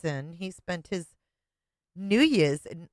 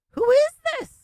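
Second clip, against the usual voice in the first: high, loud shocked squeal; 6 dB louder than usual. Real, not acted. Co-host squeals in disbelief, high and loud.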